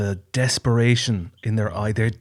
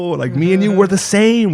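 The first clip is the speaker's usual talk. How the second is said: rising passionate insistence, louder; emphatic; 8 semitones higher, 6 dB louder than usual. Real, not acted. To his friend, passionate loud insistence. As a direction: press it home to a friend. Emphatic rant about shared suffering.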